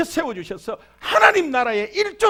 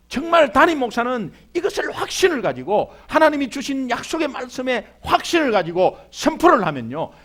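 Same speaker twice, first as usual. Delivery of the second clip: ordinary talk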